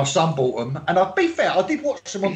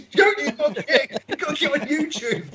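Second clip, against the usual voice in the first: higher, drawn-out mock alarm; panicked interrupter; 10 semitones higher than usual. Imagining someone bursting into the wedding, high mock panic. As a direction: cry out as if interrupting a speech. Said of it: "when he's giving a speech".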